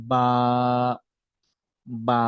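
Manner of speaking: বা with both lips pressed, then released; বা, lips closed and released; Arabic ba demo. Shown as a demonstration. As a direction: press the lips together and pop out বা. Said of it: "দুইটা ঠোঁট একই সাথে লাগানো হবে".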